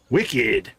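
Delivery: quick mock Boston accent exclamation. Player voicing Jules with a brief Boston-accented "Wicked!". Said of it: "Evidently, Jules is from Boston".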